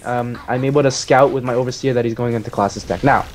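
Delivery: ordinary talk, nothing marked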